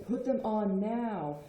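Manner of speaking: slow, drawn-out insistent order; exasperated command. Acted. Parent who already told you ten times to put shoes on, exasperated command. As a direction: spell it out after telling them repeatedly. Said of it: "if I've already told you, like, ten times".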